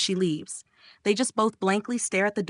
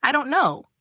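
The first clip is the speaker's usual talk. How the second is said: higher, louder near-tearful yell; crying yell; 7 semitones higher, 4 dB louder than usual. A narrator's reading. Flustered guy yells at the teasing friend. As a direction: yell it close to tears. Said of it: "He begins almost crying and stutters, yelling".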